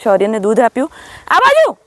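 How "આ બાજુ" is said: very high, carrying call; calling animals over. Real, not acted. Woman calling Lakshmi and Gauri over, high calling out. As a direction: call them in with a high, affectionate cry. Directing her cows.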